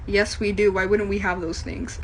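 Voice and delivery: ordinary talk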